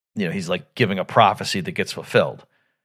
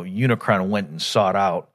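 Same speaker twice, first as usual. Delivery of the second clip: usual speaking voice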